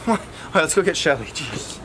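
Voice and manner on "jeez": breathless, barely voiced sigh; out of breath. Real, not acted. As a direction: exhale the word out of breath. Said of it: "It's a little out of breath".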